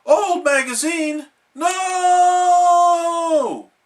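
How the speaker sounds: high, loud drawn-out scream. A narrator's reading. Patrick screaming at the old magazine, loud. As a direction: shriek in horror. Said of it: "Patrick screamed".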